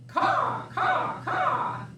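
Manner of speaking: high cawing crow. Acted. Reading the farm book, cawing like crows. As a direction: caw like a crow.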